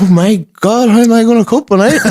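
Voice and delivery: high, fast mock panic of an old lady; fretting granny. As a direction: worry aloud like a frazzled granny. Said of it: "looking at me like".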